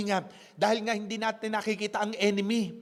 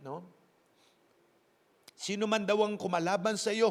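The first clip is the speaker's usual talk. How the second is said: ordinary talk, nothing marked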